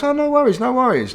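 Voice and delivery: high, loud, breezy reassurance; imitating the dealer. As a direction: chirp it out like an eager dealer. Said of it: "like that".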